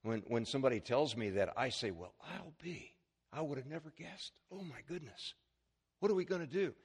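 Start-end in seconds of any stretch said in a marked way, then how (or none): 1.9-6.7 mock stunned dismay. Pastor acting his reaction to a cancer call, hushed mock dismay. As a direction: softly act out being floored by bad news. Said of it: "that's what we humans do".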